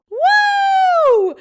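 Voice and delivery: high, loud drawn-out whoop; celebratory whoop. Acted. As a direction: cheer the release with a whoop. Cheering the prison release.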